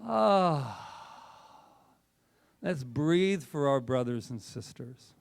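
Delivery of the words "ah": long exhale. Real, not acted. Exhale after breathe.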